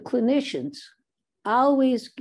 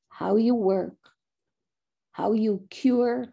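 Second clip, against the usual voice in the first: ordinary delivery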